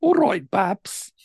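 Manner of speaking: drawn-out Midlands greeting; Midlands accent. Shown as a demonstration. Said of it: "you get to the Midlands".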